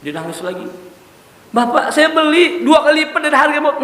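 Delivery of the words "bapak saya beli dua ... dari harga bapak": high, loud, with a laugh in it; eager generous offer. Retold or imitated. The shop owner eagerly offers the weeping tailor double. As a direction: win him over with a cheerful offer.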